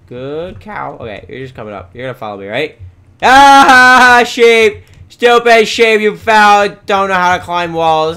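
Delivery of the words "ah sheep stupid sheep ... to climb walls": loud, high gloating shout; mock the fallen animal at full volume; jeering yell. Jeers at fallen sheep.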